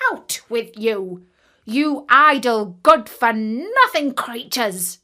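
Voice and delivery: put-on old raven parents barking at their chicks; scolding old ravens. A narrator's reading. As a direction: drive the lazy young out of the nest. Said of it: "cried they".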